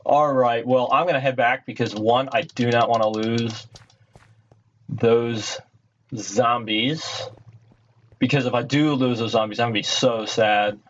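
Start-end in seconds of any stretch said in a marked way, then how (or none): none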